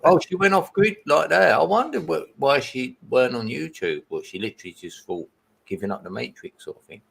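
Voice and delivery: ordinary delivery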